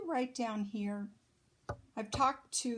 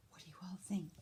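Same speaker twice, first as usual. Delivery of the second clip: whisper; 9 dB quieter, much faster than usual. Real, not acted. Whispered question to kids.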